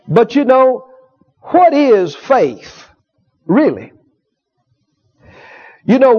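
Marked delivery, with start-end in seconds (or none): 1.4-3.9 slow rhetorical question. Real, not acted.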